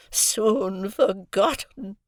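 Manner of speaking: slow breathy whispered moan. A narrator's reading. Havisham to Estella, a slow whispered moan. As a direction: let it sigh out of her. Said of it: "moaned Miss Havisham".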